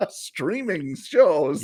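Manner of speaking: slowed, laughing through words; giggling as you say it; laughing while talking. Real, not acted. Laughs mid-sentence.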